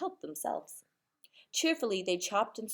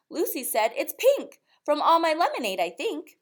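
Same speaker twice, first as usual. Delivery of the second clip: put-on eager child; 5 semitones higher, 4 dB louder than usual. A narrator's reading.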